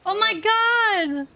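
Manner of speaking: high, loud, drawn-out gasp of delight; squealed disbelief at a reunion; overjoyed shock. Real, not acted. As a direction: stretch it out in amazed relief. Reunion with game friends.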